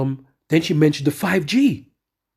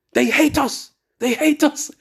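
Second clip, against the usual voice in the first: woman's voice, higher, anxious warning; a higher voice for the shop woman; high mock warning; 12 semitones higher, slower than usual. Preacher voicing the shop woman, high and warning.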